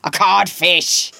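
loud, slow, falling pronouncement; Hook. A narrator's reading. Peter insults Hook from hiding, booming as him. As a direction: deliver the insult as a booming pirate captain. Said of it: "answered in Hook's voice".

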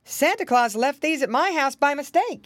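lightly voiced visitor explaining himself; clear, lifted character voice; Uncle Wiggily. A narrator's reading. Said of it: "there stood Uncle Wiggily Longears".

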